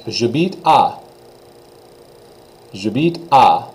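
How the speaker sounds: j'habite à, falling on à; teacher demo; French pronunciation. Shown as a demonstration. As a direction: model j'habite à.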